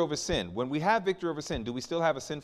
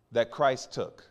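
ordinary delivery

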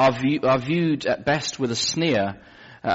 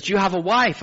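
higher, bright-eyed nurse voice; eager nurse; 10 semitones higher, 4 dB louder than usual. Retold or imitated. Nurse asking the patient about America, eager and high. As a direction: play the starstruck nurse, pitched up.

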